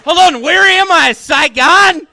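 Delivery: high, loud, bewildered cry; mock confusion. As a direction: act lost and startled, going way up. Repeats the confused bit.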